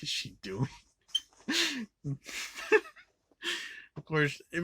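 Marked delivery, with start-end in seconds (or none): 1.5-3.0 squealing laugh; can't hold the laugh in; high giggle. Real, not acted. Laughing at the scene.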